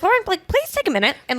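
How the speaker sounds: mock a mom checking her daughter; imitating mom. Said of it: "she'll be like".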